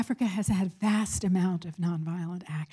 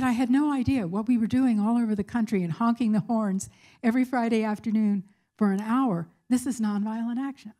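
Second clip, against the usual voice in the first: retold thrilled excitement; 3 semitones higher, 3 dB louder than usual. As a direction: imitate a thrilled student, raised pitch. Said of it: "she was so thrilled and excited".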